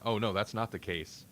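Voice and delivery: normal talking voice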